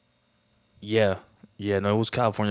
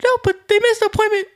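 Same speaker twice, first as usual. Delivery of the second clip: high squeaky cartoon falsetto; Mickey Mouse voice; 5 dB louder than usual. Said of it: "Mickey's like".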